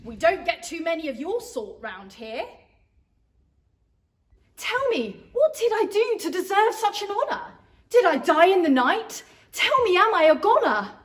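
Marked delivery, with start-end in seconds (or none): 4.5-10.9 loud, high, panicked pleading; terrified girl shouting at the angel; frightened outcry. Acted. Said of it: "Mary cried out in fear".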